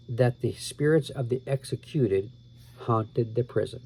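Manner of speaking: ordinary delivery, nothing marked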